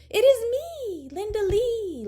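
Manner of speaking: very high, loud pleading; girl begging grandma to know her. A narrator's reading. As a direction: insist she is herself. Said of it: "Linda Lee said".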